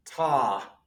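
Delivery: "Ta" with an open ah vowel; Greek article ta. Shown as a demonstration. Teacher modelling Greek "Ta" for students. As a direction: say "Ta" like tah, not to. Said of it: "That's not to, as in the English".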